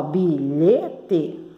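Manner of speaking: BILHETE split slowly into three syllables. Shown as a demonstration. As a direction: say "bilhete" in separate slow chunks. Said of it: "bilhete tem três sílabas". ("bilhete" sílaba por sílaba, devagar)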